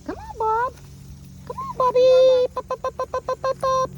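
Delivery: very high sing-out dog call; high encouraging voice. Shown as a demonstration. Trainer demonstrating calling Bob, high encouraging voice. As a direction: squeal the name, then rapid little bops. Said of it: "when you use a high inflection voice".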